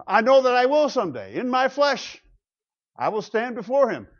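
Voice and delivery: high, emphatic declaration; Job. Acted. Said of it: "Job talks like this".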